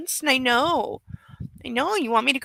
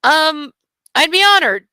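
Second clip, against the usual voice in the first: a touch stunned, pitched up; mildly stunned; 5 semitones higher, 5 dB louder than usual. A narrator's reading. Brother asked to be best man, mildly stunned. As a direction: accept as if caught off guard. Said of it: "He sounds stunned".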